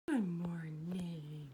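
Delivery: slow, low whispery morning greeting; drowsy-slow hushed murmur, pitched low. Real, not acted. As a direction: draw it out softly, barely voiced. Soft morning greeting to pet.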